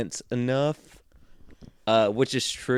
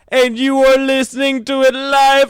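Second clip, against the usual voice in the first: announcer voice; 7 dB louder than usual. Acted. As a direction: boom it out like a show intro. Mock announcer intro over music.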